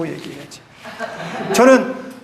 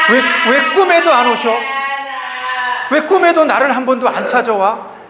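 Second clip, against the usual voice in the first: louder, higher pleading with an absent mother; hurt plea; 3 semitones higher, 4 dB louder than usual. Acted. Grown child asks mother why she never comes in dreams, hurt pleading. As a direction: cry out a pained why.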